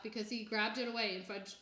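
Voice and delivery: usual speaking voice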